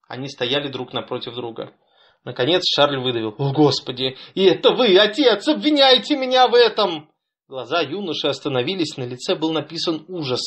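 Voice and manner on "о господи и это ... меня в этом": rising, louder, desperate cry; horrified. A narrator's reading. Son horrified that his father accuses him. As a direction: plead in disbelief.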